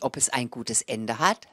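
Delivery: usual speaking voice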